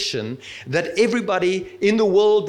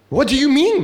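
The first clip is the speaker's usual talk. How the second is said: higher, louder outburst of disbelief; startled exclamation; 7 semitones higher, 6 dB louder than usual. Acted. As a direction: blurt it out in shock. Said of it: "exclaimed Nicodemus".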